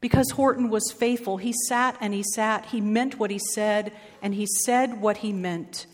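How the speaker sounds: ordinary delivery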